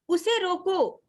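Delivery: उसे रोको as a complete command, then silence; pause after रोको. Shown as a demonstration. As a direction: halt clearly after रोको. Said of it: "फिर हमें थोड़ा सा रुकना है".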